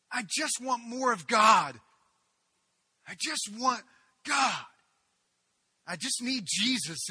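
loud, high, slow cry of longing; desperate cry. Retold or imitated. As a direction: call out for God with everything. Retold cry for God.

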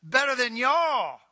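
drawn-out smug jeer; smug taunt. Retold or imitated. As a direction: Israel taunting Judah, gloating slowly. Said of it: "they're looking at Judah going".

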